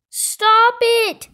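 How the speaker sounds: put-on high, loud boy's shout. A narrator's reading. Kipper under attack from villagers, shouting. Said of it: "shouted Kipper".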